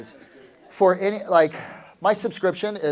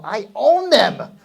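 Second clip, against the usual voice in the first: "I own them" slow, loud and drawn out; confident declaration; 6 semitones higher, 10 dB louder, much slower than usual. Shown as a demonstration. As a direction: claim it boldly, slowly. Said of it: "Turn to your partner and go".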